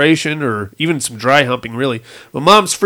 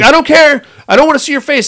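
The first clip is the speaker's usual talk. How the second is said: much higher, louder insistence; dad refusing to see his face, high raised voice; retold yell; 10 semitones higher, 4 dB louder, faster than usual. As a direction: push it up high and loud.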